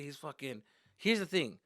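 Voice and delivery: ordinary delivery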